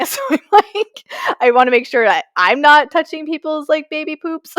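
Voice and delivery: high, laughing disgust. Real, not acted. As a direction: squeal it out, amused and grossed out. Laughing about disgusting tray tables.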